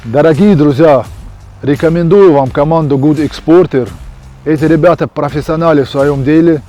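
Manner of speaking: advertisement read. Acted. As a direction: sell it like a TV ad. Fighter reads the sponsor ad. (рекламный текст, громко и бодро)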